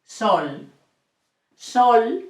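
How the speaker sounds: sol with a pure round o; Spanish sol. Shown as a demonstration. Teacher modelling the word for sun. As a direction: say sol short and clean. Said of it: "another word with O in Spanish".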